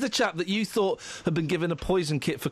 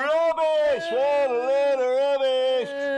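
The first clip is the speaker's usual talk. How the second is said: loud, high terrace jeer; mock heckling; 8 semitones higher, 4 dB louder, much slower than usual. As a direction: jeer slowly like an angry crowd. Mock heckling after news.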